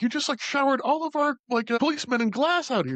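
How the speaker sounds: high-pitched mock indignation; imitated outraged complaint. Podcaster imitating the police chief's complaint, mock outrage.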